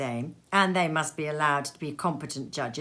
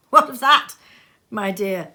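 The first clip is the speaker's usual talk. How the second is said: higher, louder jolly retort; hearty retort; 4 semitones higher, 9 dB louder than usual. Acted. Nephew to his wife, louder and jolly. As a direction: brush it off heartily.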